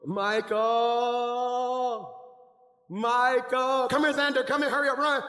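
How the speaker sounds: loud, drawn-out names, then urgent orders; urgent shouted beckoning; calling out. Acted. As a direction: summon someone from across the room. Calls helpers over to act scene.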